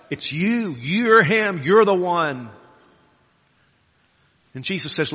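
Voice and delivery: ordinary talk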